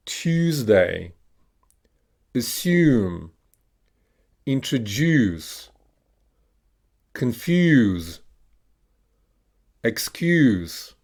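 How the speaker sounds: each word alone, drawn-out YOO as in "confuse"; "assume" with a long YOO, falling tune; long U modelled. Shown as a demonstration. Teacher has learners repeat long U words, careful drill delivery. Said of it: "we're gonna pronounce the letter".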